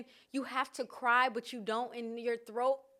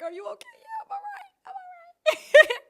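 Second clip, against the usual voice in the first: very high, squeaky fake crying; mock tearful reassurance; 12 dB louder than usual. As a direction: sob through claiming to be fine. Acted driver and crying passenger exchange.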